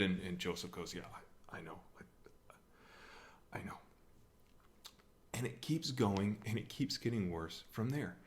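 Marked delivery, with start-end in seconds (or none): 0.9-3.8 stammering, hushed, resigned admission; sheepish near-whisper. Retold or imitated. Joseph answering angry parents about his kid, sheepish near-whisper. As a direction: trail down into an embarrassed whisper.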